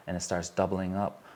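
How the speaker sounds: usual speaking voice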